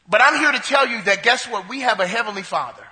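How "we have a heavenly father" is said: ordinary talk, nothing marked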